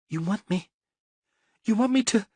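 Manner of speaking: a cornered wife begging in panic; frightened woman. A narrator's reading.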